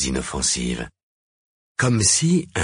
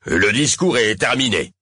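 higher-pitched declaration of an ending; curt announcement; 6 semitones higher, 4 dB louder than usual. A narrator's reading. Mayor dismissing the surprised public, curt. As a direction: declare it over, with finality. Mayor abruptly ends the event.

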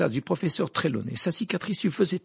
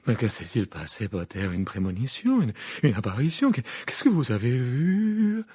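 eager, loud mystical teacher; breathless fascinated questioning; overexcited; 4 dB louder than usual. Acted. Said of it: "l'air surexcité".

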